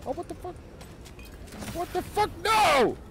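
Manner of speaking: high, loud panicked yelp; startled panic. Real, not acted. Gamer panics after an unexpected pickup, high-pitched alarm. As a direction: shriek in sudden alarm.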